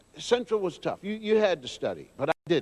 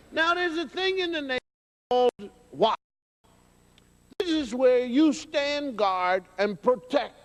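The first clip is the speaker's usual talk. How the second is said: normal talking voice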